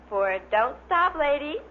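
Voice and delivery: ordinary delivery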